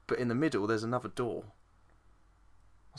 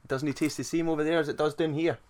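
put-on Scottish accent, slightly raised; 4 semitones higher, 4 dB louder than usual. Teasing friend over Irn-Bru, mock Scottish accent. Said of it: "You're not Scottish".

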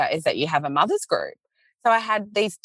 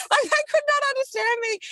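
laughing. Real, not acted. Laughs retelling the confusion.

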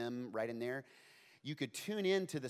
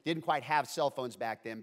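normal talking voice

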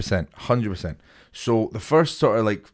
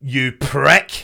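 higher and louder, a cheeky parting jab; mock insult; 4 semitones higher, 5 dB louder than usual. Friend adding the insult to the cyclist, loud cheeky jab. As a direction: fling the insult with relish. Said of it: "That's not enough".

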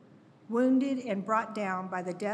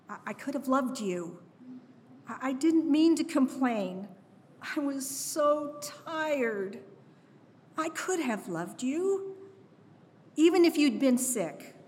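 brokenhearted mantra; 4 semitones higher than usual. Retold or imitated. Grieving mother speaking to her dead daughter, near breaking. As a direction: plead with the lost baby, growing more desperate. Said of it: "My brokenhearted mantra".

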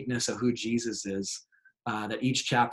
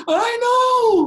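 high, loud squeal of delight; imitating a thrilled shriek; excited; 10 dB louder than usual. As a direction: gush it out like an overjoyed kid. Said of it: "He's like".

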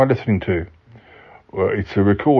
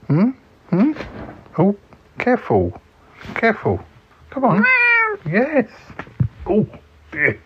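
coaxing pet voice; 12 semitones higher than usual. Acted. Coaxing a bouncy creature.

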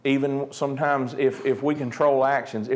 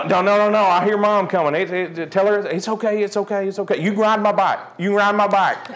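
higher-pitched mock panic; panicked bargaining; 6 semitones higher than usual. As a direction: play a kid desperately placating a brother before Mom arrives. Panicked kid as mom comes.

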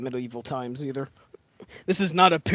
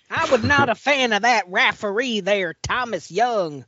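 laughing mock southern drawl; Mississippi accent; 6 semitones higher, 4 dB louder than usual. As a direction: put on a Mississippi twang, laughing. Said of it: "It's about time I do it to somebody else".

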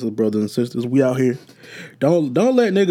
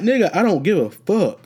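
dismissive retort; 7 semitones higher, 4 dB louder than usual. Retold or imitated. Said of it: "I'm like".

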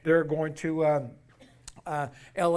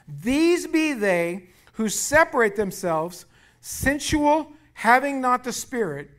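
slow, raised scripture reading; preaching scripture; 5 semitones higher, 5 dB louder, much slower than usual. Acted. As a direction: read the verse with weight.

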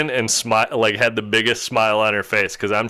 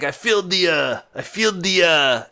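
put-on old man's Dutch accent, higher pitched; 6 semitones higher than usual. Old man at the truck window telling his story, Dutch accent. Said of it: "that's definitely what the accent sounded like".

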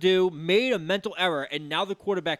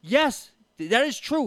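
louder, higher, mock-emphatic yes; half-hammed loud concession; emphatic retold agreement; 6 semitones higher, 4 dB louder than usual. Voicing Brady, loud agreement.

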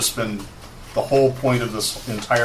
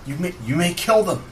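high, pleading wizard voice; frantic old wizard; 10 semitones higher than usual. Acted. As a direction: urgently beg them to stop. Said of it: "And he says".

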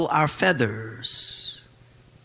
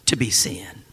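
whispered aside; 9 semitones lower, 4 dB quieter than usual. Acted. Preacher whispering to the congregation. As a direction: drop to a sudden whisper.

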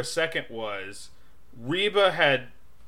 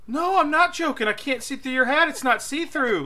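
high, pleading insistence; insistent; 12 semitones higher than usual. Retold or imitated. High insistent reply to the hat guy. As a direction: insist it's no joke, high. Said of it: "And I go".

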